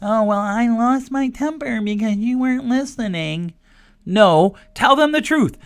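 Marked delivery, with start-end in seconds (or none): 0.0-3.5 a lightly put-on, higher voice of a parent making excuses; mock parent excuse. Host mocking parents who blame their kids, lightly put-on whine. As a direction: shift the blame onto the kid, mock-whiny. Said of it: "You have to stop telling your kids".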